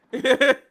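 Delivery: high, loud burst of laughter; sudden tickled laugh; amused laugh. Real, not acted. Amused by Naomi's retort.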